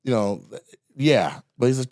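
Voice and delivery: ordinary talk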